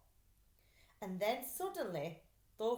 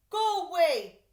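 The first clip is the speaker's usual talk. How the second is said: loud, high go away through tears; upset shout; 9 semitones higher, 13 dB louder than usual. Acted. Stanley shouting at his brother, loud and upset. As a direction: push him away loudly. Crying boy sends brother off.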